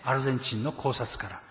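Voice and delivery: ordinary delivery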